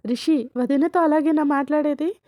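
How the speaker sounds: hammed-up angry outburst; retold shout. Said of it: "అని రిషి గట్టిగా అరుస్తాడు".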